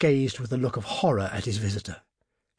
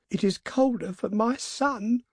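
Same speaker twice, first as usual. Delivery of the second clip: put-on high, plaintive old woman; acted lament, pitched well up; tearful grief; 11 semitones higher than usual. A narrator's reading. Grieving mother at the window answers her husband, tearfully. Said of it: "and wept afresh".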